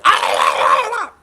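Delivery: loud falsetto shriek; hammed-up startled screaming; mock scream. Deputy holding the mask, high mock scream.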